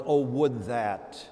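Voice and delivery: slow, longing drawn-out wish; slow wistful sigh. Shown as a demonstration. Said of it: "begins with the words".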